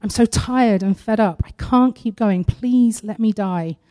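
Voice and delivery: ordinary talk, nothing marked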